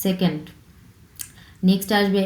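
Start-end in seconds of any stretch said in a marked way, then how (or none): none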